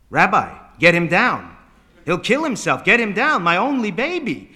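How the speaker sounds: loud, urgent demand rising in pitch; frantic mother. Acted. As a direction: plead at full volume for your child. Mother frantic for son on roof.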